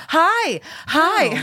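high, squeaky fan hello; imitated eager greeting. Fan running up with her book, high eager greeting.

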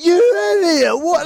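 imitating a shocked teammate; mock outrage. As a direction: squeal it in disbelief. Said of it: "Zach's like".

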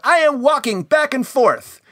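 high, drawn-out mock holler; mock yell. Imitating Harley interrupting the planning, high mock yell. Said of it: "yells out".

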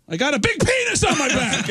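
high-pitched, hammed-up wail; anguished outburst. Drummer returning to his band, anguished outburst. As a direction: wail it high in mock despair.

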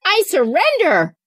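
high-pitched mock cry of surrender; mock yell. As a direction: give a playful, high mock yell without much volume. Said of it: "throw my hands up and yell".

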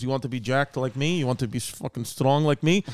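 put-on a low Russian-accent boast; mock Russian mover bragging. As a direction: brag like a jacked Russian mover. Mover bragging about his muscles.

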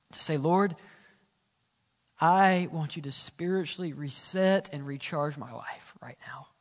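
hushed, sincere congregational prayer; soft earnest prayer. Acted. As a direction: pray it quietly over the room.

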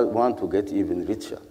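usual speaking voice

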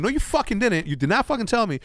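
high, indignant contradiction; retold argument. Arguing with Flame over not being told, high indignation. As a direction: push back hard, acting out the quarrel. Acted quarrel with teammate.

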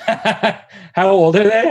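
asked through a laugh, slowly. Real, not acted. Guest laughing as he asks about her boys. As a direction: laugh while you ask it. Laughing while asking host.

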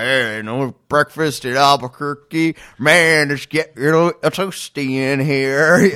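hammed-up Southern drawl, stretched out; Southern accent. Podcaster mocking the cliché astronaut in a Southern drawl. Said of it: "I don't know why he has a Southern accent in my version".

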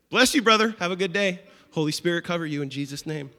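loud, beaming churchy blessing; mock super-spiritual blessing. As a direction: overdo the holy talk to drive them off. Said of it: "super spiritual with them".

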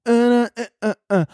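ordinary talk

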